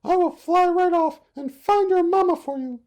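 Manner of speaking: very high, loud dove voice; kind mother dove. Acted. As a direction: promise eagerly like a mother bird. Dove promising to help.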